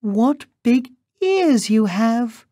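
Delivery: put-on very high, wondering child's voice; gasping little girl. A narrator's reading. Girl marvels at the disguised wolf, high and astonished. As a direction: pipe it up in astonishment. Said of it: "she gasped".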